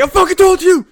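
high, loud exasperated teacher yell; imitated angry shout. Voicing the irritated teacher.

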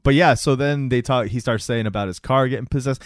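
normal talking voice

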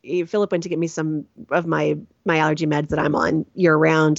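ordinary talk

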